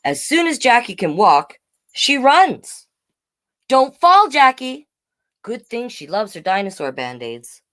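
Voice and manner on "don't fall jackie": high, louder call out; calling out warning. Acted. Big sister calling after running Jackie, anxious shout. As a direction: warn the running toddler.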